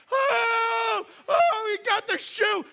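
very high, loud, thrilled cheer; cheering crowd. Retold or imitated. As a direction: cheer like the crowd going wild. Crowd cheers as shoe emerges.

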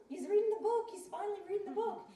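loud, high-pitched excited squeal; thrilled. Retold or imitated. Wife discovers husband finally reading, thrilled. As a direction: burst out in delighted disbelief. Wife's thrilled inner reaction.

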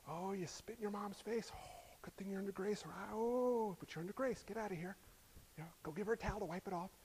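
hushed, indulgent parent; whispered mock-lenient parent. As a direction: brush it off in a breathy hush.

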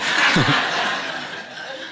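soft airy chuckle; breathy laugh. Real, not acted. Pleased with the story's ending, a soft breathy laugh. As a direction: let a quiet laugh escape.

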